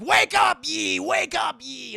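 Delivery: high, loud, punchy shouting; mock wake-up yell. Dad rouses the bewitched twins, yelling.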